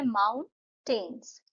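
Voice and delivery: माउंटेन्स said slowly as माउन-टेन्स; syllable beats. Shown as a demonstration. Teacher repeats माउंटेन्स slowly to show two syllables. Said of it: "दो sound आ रहे हैं".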